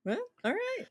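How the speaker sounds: high, coy mock consent; lightly hammed coy agreement; coy giving in. Woman giving in to seducer, coy high voice.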